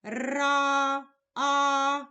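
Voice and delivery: "Ра, а" with the а held long and level; drawn-out vowel. Shown as a demonstration. Teacher picking out the vowel for a child, drawn out. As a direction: sustain the vowel in "Ра", then "а" alone.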